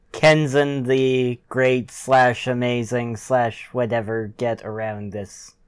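usual speaking voice

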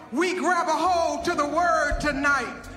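ordinary delivery

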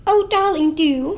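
high, loud coaxing plea; urging. Acted. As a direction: coax him sweetly to go ahead. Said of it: "Marjorie urged".

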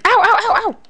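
loud, high string of ows; rapid mock yelps. Player getting hit by game enemies, pained yelping. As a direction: yelp repeatedly as if being hit.

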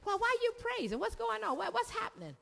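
high-pitched, eager questioning; acted excited curiosity; curious onlookers. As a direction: imitate puzzled bystanders, pitch jumping up. Acting curious onlookers questioning the worshipper.

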